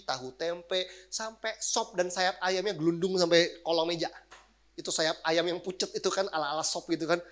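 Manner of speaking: ordinary talk, nothing marked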